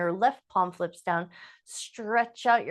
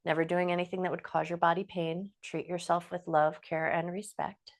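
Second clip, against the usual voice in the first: guided breathing; 4 dB quieter than usual. Acted. Yoga teacher settling the class into breath, in a soft guided-breathing voice.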